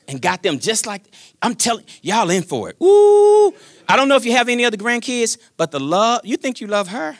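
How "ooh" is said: long, high, loud "ooh"; loud high-pitched sustained ooh; excited whoop. Real, not acted. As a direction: let out a held whoop of delight. Exclaims over love for grandkids.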